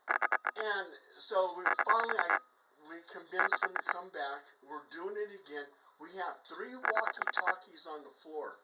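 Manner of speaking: normal talking voice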